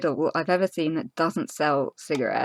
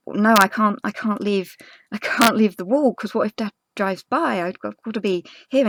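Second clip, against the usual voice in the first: panicked refusal; 6 semitones higher, 5 dB louder than usual. Retold or imitated. Panicking, refusing to leave wall.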